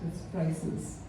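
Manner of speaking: normal talking voice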